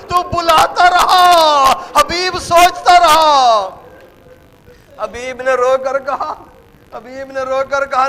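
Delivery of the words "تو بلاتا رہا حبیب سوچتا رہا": loud, higher, slow climactic preaching; preaching at full force. A narrator's reading. Preacher drives the majlis to its peak, loud and slow. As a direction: hold the peak of the sermon.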